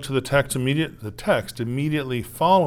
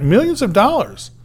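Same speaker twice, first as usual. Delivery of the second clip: higher and louder, marvelling; amazed exclamation; 8 semitones higher, 8 dB louder than usual. Real, not acted. Preacher marvelling at the forgiven debt, loud and high. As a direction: make them feel how huge it is.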